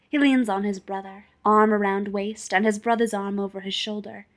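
ordinary delivery

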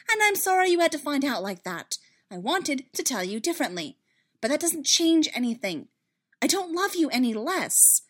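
high, loud, urgent apology; heated, heartfelt explanation; earnest defence. Acted. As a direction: plead your case, insisting. Padme defending herself in quarrel.

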